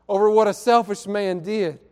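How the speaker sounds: ordinary talk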